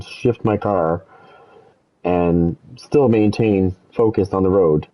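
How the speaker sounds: slow, spelling out each step; slowed for effect. Retold or imitated. Driver listing tasks to the cop, slow and deliberate. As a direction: drag out every task one by one. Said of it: "if I could do that".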